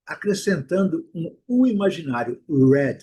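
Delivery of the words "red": red with lips pushed out into a pout; rounded English r. Shown as a demonstration. Teacher modeling red with rounded lips. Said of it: "Faz um bico".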